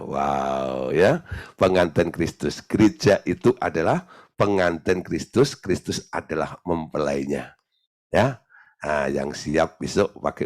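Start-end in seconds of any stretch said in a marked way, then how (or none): none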